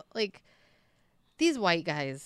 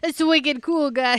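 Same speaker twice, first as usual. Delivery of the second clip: loud, higher-pitched mock Boston accent; hammed-up Boston accent, belted out; 7 semitones higher, 10 dB louder, slower than usual. Podcaster imagining aliens with a Boston accent, loud mock Boston accent. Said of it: "a Boston accent".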